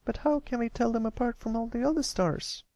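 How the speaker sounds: put-on high, frustrated young filly; a filly annoyed at her telescope; fuming little filly. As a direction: huff at the telescope. Sweetie Belle asks.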